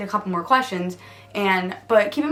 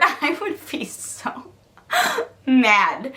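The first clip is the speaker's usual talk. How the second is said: breaking into giggles mid-sentence; laughing; 5 semitones higher, much slower than usual. Real, not acted. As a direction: laugh through it, then land on mad. Laughing about angry parents.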